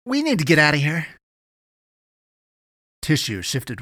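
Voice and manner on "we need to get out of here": high, loud, urgent alarm; rising terror. A narrator's reading. Eddie urges Avery to flee the chamber, rising terror. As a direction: raise the voice as fear mounts. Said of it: "he said with rising terror".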